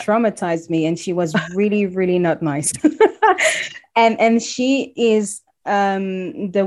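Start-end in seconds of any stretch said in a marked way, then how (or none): 2.8-3.9 a sudden high burst of laughter; bright high laugh. Real, not acted. Guest laughing at her bus-stop story, high laugh.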